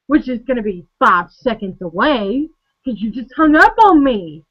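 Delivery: ordinary talk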